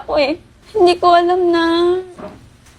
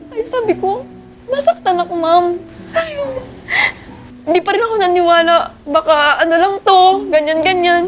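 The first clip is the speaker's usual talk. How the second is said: hurt; 6 semitones higher than usual. Real, not acted. Jilted bride recounts heartbreak.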